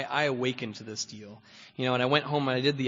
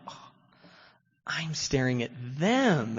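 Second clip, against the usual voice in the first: slow realization; 4 dB louder, slower than usual. Retold or imitated. Sudden realization.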